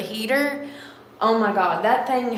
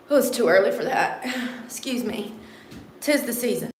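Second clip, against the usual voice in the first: ordinary delivery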